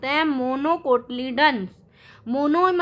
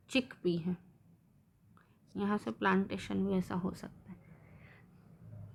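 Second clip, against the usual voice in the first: whispered aside; 6 semitones lower, 12 dB quieter than usual. Real, not acted. Teacher aside while drawing seeds.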